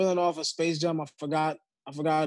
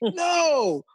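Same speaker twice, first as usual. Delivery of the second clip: overacted death scene; 11 semitones higher, 4 dB louder than usual. Mock dying Goblin.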